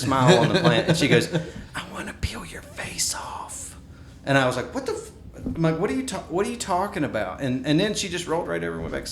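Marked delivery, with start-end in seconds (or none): 1.8-3.8 put-on high, eerie whisper of a little girl; creepy whisper. Creepy child quote at night. 4.7-5.1 sharp, high-pitched shocked cry; startled outburst. Retold or imitated. Father jolted awake by creepy child. 5.8-7.4 high, fast, rattled disbelief; alarmed question. Retold or imitated. Father startled by creepy daughter.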